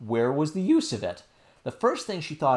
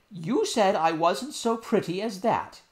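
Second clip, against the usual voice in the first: hurt nurse; 8 semitones higher, 3 dB louder than usual. Acted. Nurse voiced.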